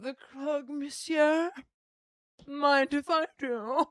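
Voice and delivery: mimicking a guest. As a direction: imitate her with a lilting rise at each end. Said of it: "But she's like".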